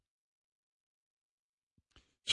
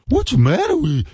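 much higher, mock-angry squawk; fake anger. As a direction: parody his put-on outrage. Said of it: "That fake kind of anger that he has".

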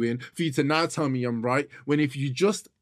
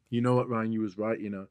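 imitating an apology; 5 semitones lower, 5 dB quieter than usual. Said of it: "turn around and go".

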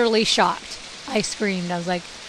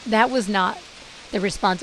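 ordinary talk

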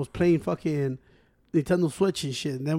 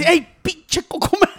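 higher, drawn-out taunt; hammed-up movie line; 9 semitones higher, 3 dB louder, slower than usual. Retelling a funny movie scene to friends, hammed-up taunt. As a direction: stretch out the hey like a movie tough. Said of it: "Y le hace".

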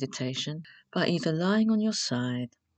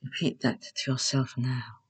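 guided meditation; 4 dB louder than usual. Acted. Host leading beginners through Yoga Nidra, calm guided meditation. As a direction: guide them unhurriedly into rest, phrase by phrase.

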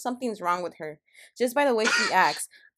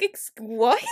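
stunned disbelief; 3 dB louder than usual. Retold or imitated. Worker reacting to a customer's insult in shock. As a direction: blurt it out in shock. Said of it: "I'm like".

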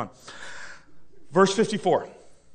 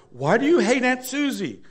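talk show host; 7 semitones higher, 4 dB louder than usual. Pretend. Host interviewing talk show guests.

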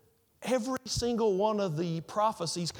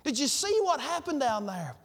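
excited; 6 semitones higher, slower than usual. Retold or imitated. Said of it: "still kind of floating through the air".

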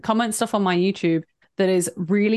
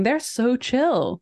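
ordinary talk, nothing marked

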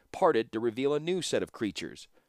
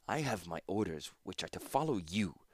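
softer, words pushed through clenched teeth; 2 semitones lower, 6 dB quieter than usual. Acted. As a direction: force it out through gritted teeth. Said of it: "replied through clenched teeth".

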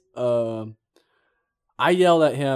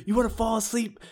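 retold yelling; 9 semitones higher than usual. A Marine yelling at a kid who slept in class, high-pitched blast. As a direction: tear into the sleepy kid. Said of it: "I blasted him, put him on blast".

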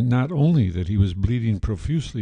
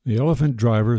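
usual speaking voice

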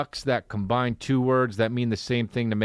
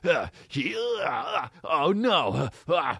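panicked anime character; 3 semitones higher, slower than usual. Retold or imitated. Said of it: "And he'll be like".

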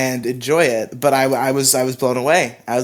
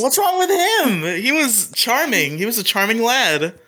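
mock defending; 9 semitones higher than usual. Cohost teasingly defends the uncle.

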